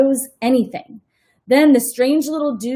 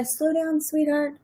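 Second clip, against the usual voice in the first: gentle teacher correction; 6 semitones higher, 7 dB quieter than usual. Shown as a demonstration. Teacher gently slows a child reader down, softer. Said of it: "I'd say".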